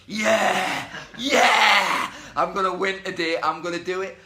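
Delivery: high, loud manic self hype-up; over-the-top insane cheer, easing into loud resolve; Joker. Shown as a demonstration. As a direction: shout it like a crazed villain psyching up. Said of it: "like, like the Joker".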